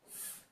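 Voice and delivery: "F" as a soft voiceless breath through the teeth; "F" as a faint unvoiced fricative; F sound. Shown as a demonstration. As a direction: blow out "F" with no vowel. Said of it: "o F faz esse som".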